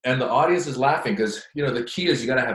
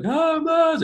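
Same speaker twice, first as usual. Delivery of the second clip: much higher, louder mock drunk fan; drunk gushing fan; 6 dB louder than usual. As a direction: gush like a tipsy superfan.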